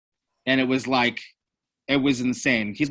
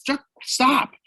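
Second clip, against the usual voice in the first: mock yell; 12 semitones higher than usual. Bandmate in the van yelling at the driver to stop, mock yell. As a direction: snap it out like an annoyed bandmate.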